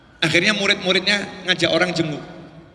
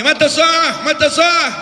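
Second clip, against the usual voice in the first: high, raised yell with Arabic words; imitated villager's shout; 7 semitones higher, 4 dB louder, slower than usual. Villager interrupting the Prophet's lecture, shouting. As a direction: blurt it out loudly over a lecture. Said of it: "Dia teriak-teriak".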